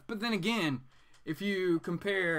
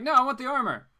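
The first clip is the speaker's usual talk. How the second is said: insistent customer; 10 semitones higher, 9 dB louder, faster than usual. Retold or imitated. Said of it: "But she's like".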